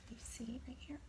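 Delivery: hushed whisper. Real, not acted. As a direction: point and whisper softly. Teacher whispers pointing.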